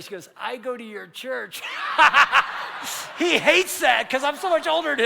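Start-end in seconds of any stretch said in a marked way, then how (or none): 0.4-1.6 slow, laughing line; laughing through the quote. Retold or imitated. Preacher retelling the Costco woman, laughing. 1.7-3.1 big, breathy laugh; loud burst of laughter. Real, not acted. Preacher laughing at his own Costco story, loud.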